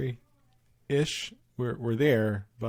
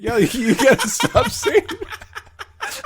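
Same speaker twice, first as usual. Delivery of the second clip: high, slow words squeezed through laughter; laughing so hard the plea barely gets out; laughing protest; 7 dB louder, much slower than usual. Retold or imitated. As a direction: beg him to stop while cracking up. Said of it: "I'm like".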